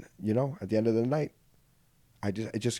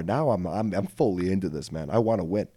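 normal talking voice

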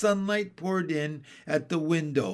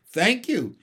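pointed thanks, raised in pitch; meaningful; 6 semitones higher, 6 dB louder than usual. A narrator's reading. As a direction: say it as a hinted rebuke. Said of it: "said Mary Poppins meaningly".

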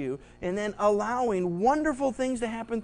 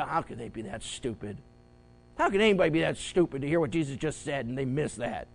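lower, mock incredulous; mimicking an audience's disbelief; scornful listener; 6 semitones lower, faster than usual. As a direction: sneer at the disciples.

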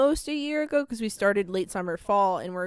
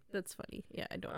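whispering; 15 dB quieter than usual. Real, not acted. Quiet reply after whisper.

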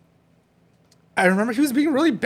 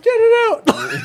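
put-on high, loud little-kid plea; mock a small boy begging, high and loud; imitating panicked toddler; 7 dB louder, much slower than usual. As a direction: son pleading to get the shirt out, high kid voice.

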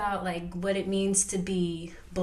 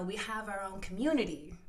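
ordinary delivery

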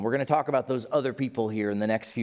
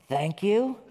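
unsure thanks; 6 semitones higher than usual. Retold or imitated. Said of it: "You're like".

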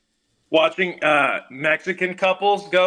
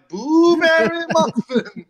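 high, slow spooky pun through a laugh; 10 semitones higher, 3 dB louder, much slower than usual. Pretend. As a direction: draw out the boo like a ghost. Halloween pun on boo.

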